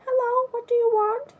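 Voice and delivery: high, snippy mock receptionist voice; mock rude receptionist. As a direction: parody a curt office worker answering the phone. Imitating rude receptionist on phone.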